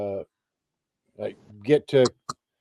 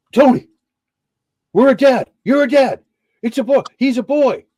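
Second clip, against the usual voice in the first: high, loud shouted announcement; excited news-breaking; 12 semitones higher, 8 dB louder than usual. Retold or imitated. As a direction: shout the birth news down the phone. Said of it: "sharing the news".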